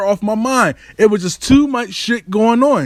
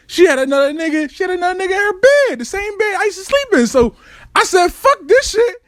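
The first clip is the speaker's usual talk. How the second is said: high, fast, rising furious rant; building rage; 13 semitones higher, faster than usual. Retold or imitated. Telling the audience about her other man, rising high furious rant. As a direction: let the anger climb higher with every line.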